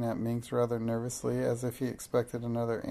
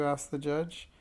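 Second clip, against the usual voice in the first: ordinary delivery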